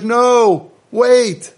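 high, loud cry of no, wait; a prophet cutting off the crying crowd, loud and high; urgent call to stop. Retold or imitated. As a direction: burst in to stop the weeping crowd. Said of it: "And he's like".

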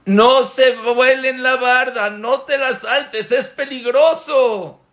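high, drawn-out impassioned cry; stretched, high-pitched pleading shout; roaring warning. Acted. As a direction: roar a slow warning at your children. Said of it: "Boreolam está rugiendo".

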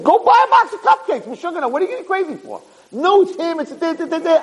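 high-pitched, squeaky comic rant; hammed-up frantic bickering; heated mock argument. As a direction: send up a crazy squabble in a high squeal. Retelling wife and himself in heated argument.

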